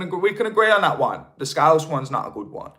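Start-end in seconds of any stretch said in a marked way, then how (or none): none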